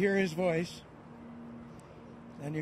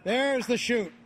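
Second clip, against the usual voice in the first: louder, thrilled commentator call; excited; 5 semitones higher, 4 dB louder than usual. Real, not acted. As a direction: burst out with relief and joy. Commentator sees the parachute open.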